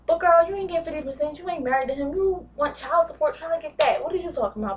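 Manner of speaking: sassy telling-off. Real, not acted. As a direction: call her out, exasperated. Telling Jocelyn off.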